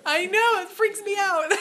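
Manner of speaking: high, loud giggling; real laughter at a surprise; laughing. Real, not acted. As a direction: laugh it out, half embarrassed.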